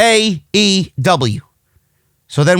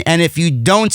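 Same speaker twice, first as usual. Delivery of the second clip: usual speaking voice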